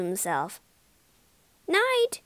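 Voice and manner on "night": very high little squeak; squeaked reply. A narrator's reading. Disguised Toad replying to the prison guard, squeaked high. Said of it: "he squeaked in reply".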